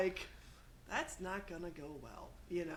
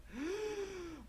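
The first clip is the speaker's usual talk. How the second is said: mock gasp; 11 semitones higher than usual. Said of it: "some parts being like".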